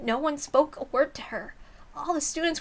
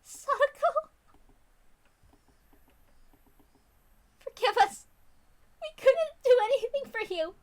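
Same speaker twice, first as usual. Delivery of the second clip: tearful plea; 13 semitones higher than usual. Acted. Rena holding Sadako and crying, high tearful plea. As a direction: beg forgiveness through tears. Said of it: "started crying with her".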